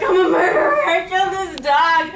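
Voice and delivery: high, loud hammed-up crying confession; tearful mock wail. Speaker confessing to sister through tears, mock wailing. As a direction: wail it out, stretching the last words. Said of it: "why are you crying?".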